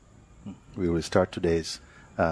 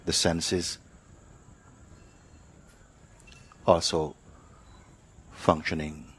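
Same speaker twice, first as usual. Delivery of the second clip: guided meditation; 3 dB quieter than usual. Real, not acted. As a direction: lead them unhurriedly, phrase by phrase, into awareness.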